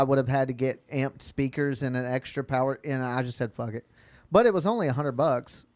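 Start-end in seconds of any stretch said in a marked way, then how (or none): none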